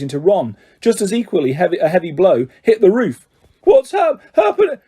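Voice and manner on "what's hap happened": high, loud gasped panic; gasping. A narrator's reading. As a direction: gasp it out in shock. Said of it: "Ron gasped".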